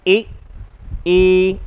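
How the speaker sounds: ए and ए sounded apart; vowel demo. Shown as a demonstration. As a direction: show the two ए vowels one by one. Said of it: "आपके दो वर्ण कौन कौन से". (दोनों ए अलग-अलग बोलकर दिखाना)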